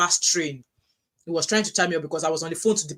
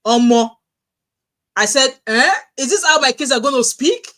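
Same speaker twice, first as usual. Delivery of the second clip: loud, high, incredulous outburst; retold astonishment; 6 semitones higher, 9 dB louder than usual. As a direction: re-enact the shock, louder and higher. Retells shock at hearing accent.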